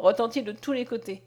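ordinary delivery, nothing marked

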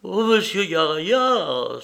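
high, loud, drawn-out exclamation; horrified cry. A narrator's reading. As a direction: cry out slowly in horror. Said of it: "s'écria-t-elle en joignant ses mains avec un geste d'horreur".

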